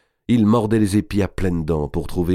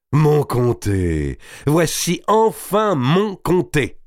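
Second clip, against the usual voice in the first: slow, high, drawn-out cry of triumph; exultant exclamation; 9 semitones higher, 3 dB louder, much slower than usual. A narrator's reading. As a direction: savour each word, soaring upward. Géant retrouve joyeusement son comté. (exclamation lente et exaltée)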